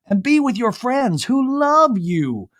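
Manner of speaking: high, slow, drawn-out mom voice; mock mom. Mom consoles her daughter, high slow mock motherly. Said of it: "her mom's like".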